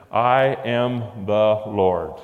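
"I am the Lord" drawn out very slowly, each word weighed; slow solemn declaration. Acted. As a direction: let it land slowly and heavily. Closing God's declaration, slowed.

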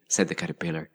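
ordinary delivery, nothing marked

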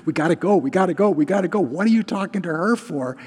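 mock an antsy man desperate to leave; frantic impatience. Official hurrying Jesus away from the woman, frantic impatience. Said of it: "like a cat on a tot-, hot tin roof".

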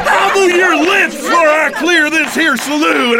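high, loud angry bark; angry shout. Acted. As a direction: roar at the witness. Judge threatening to clear saloon.

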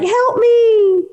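high, loud squeaky plea from a stuck chicken; trapped chicken. Retold or imitated. Cohost voicing the stuck chicken, squeaky cry for help. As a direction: voice the poor hen begging for rescue. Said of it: "the chicken's head sticking out is like".

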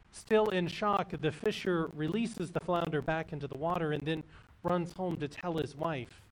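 ordinary delivery

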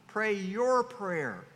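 ordinary talk

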